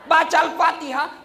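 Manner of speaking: ordinary delivery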